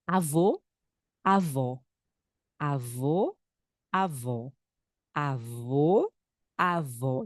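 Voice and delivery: "avô" with a closed o, "avó" with an open o. Shown as a demonstration. Teacher contrasting closed and open vowels for students. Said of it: "um sonido mais cerrado".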